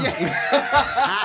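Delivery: high loud laughter; laughing. Real, not acted. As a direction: crack up laughing. Cracks up at story.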